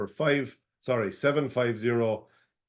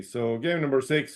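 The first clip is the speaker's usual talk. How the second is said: normal talking voice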